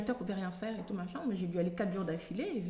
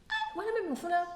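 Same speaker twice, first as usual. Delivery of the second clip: surprised spotting; 9 semitones higher, faster than usual. Retold or imitated. Spotting the boy, retold.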